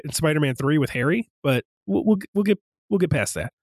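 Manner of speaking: usual speaking voice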